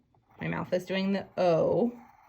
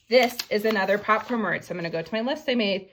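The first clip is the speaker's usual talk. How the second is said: ordinary delivery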